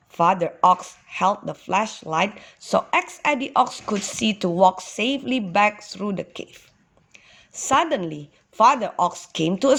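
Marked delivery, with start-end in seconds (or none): none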